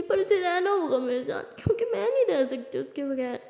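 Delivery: acted weepy insistence; tearful protest. Friend insisting Lily must stay with her, tearful protest. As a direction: whine it through tears, starting high. Crying that she cannot cope.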